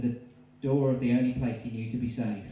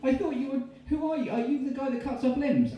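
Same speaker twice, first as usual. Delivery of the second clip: very high, loud panicked voice; horrified shriek; 13 semitones higher, 3 dB louder than usual. Acted. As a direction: shriek at the stranger in shock. Sees friend's head cut off.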